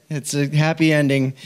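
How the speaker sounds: usual speaking voice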